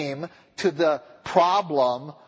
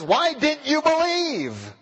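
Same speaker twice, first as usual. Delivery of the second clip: God's judgment question; 10 semitones higher, slower than usual. Acted. God at judgment voiced.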